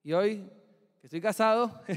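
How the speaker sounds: ordinary delivery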